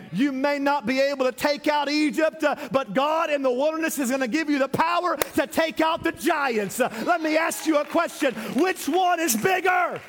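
high, loud, rhythmic preacher cadence; full-force preaching. Acted. Preacher urging the church through the wilderness, loud and high.

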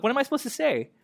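high-pitched mock bewilderment; baffled protest. Reluctant prophet asking God for words, high baffled protest. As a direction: ask it helplessly, pitched well up.

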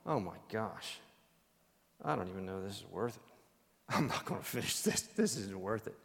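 low, soft mutter of giving up; discouraged worker. Retold or imitated. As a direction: play the worker ready to quit. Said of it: "he's going".

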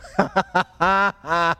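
loud staccato "ha ha ha" of derision; put-on laugh, each ha punched; mocking laugh. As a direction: laugh scornfully down at them. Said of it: "looks at them and goes".

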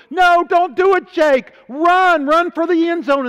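high, loud yell urging him on; retold team shout. Teammates yelling at Jake to run, loud high shout. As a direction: shout it across the field to stop him kneeling.

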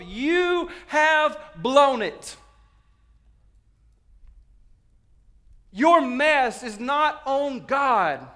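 high, very slow, loud rebuke; full-force pulpit shout. Real, not acted. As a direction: stretch each word and shout it. Confronting the congregation from pulpit.

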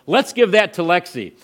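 high and loud, bursting with generosity; excited offer. Retold or imitated. Pastor eagerly offering the scholarship, excited and loud. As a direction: offer it like a sudden bright idea.

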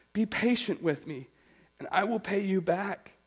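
pleading servant. Acted. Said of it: "begged him".

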